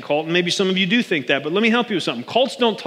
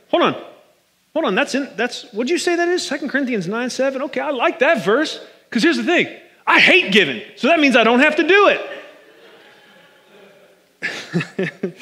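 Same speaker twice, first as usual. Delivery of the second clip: higher, sly excuse-making voice; mock reluctant giver; 6 semitones higher than usual. Preacher mocks a stingy listener's loophole, in a higher sly voice. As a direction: play the stingy churchgoer finding a loophole.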